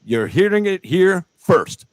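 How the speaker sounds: high-pitched breaking-news proclamation; mock announcer. Host breaks the fighter's exclusive to viewers, mock announcer. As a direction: announce it grandly, slowing into the final words.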